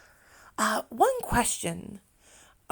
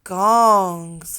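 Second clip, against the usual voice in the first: Gongs louder, final s pronounced; plural stressed; 2 semitones lower, 7 dB louder than usual. Shown as a demonstration. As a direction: hit the s in Gongs. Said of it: "But if we have two, it's gongs".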